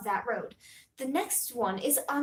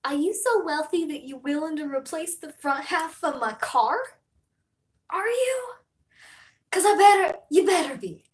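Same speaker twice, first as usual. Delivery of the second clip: mock rant; 5 semitones higher, 6 dB louder than usual. Ranting at rich driver's vanity plate.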